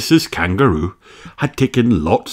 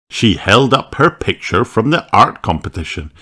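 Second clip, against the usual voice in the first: plain reading voice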